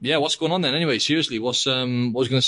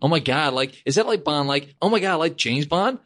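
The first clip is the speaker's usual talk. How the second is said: much higher, gushing oh my God; mock excited gushing; 6 semitones higher than usual. Imitating people reacting to the Bond name, mock gushing. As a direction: imitate starstruck fans, hammed-up. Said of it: "Is that what they're like?".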